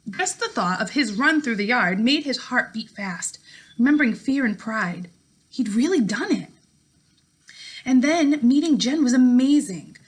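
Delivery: steady, clear read-aloud narration for a class; story narration. A narrator's reading. Teacher reading a novel chapter to her class, in an even storytelling voice. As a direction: read the chapter out to your students, voicing each character lightly.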